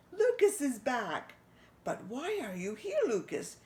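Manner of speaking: a light French-mouse voice, curious; Pierre the mouse. Acted. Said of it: "he said".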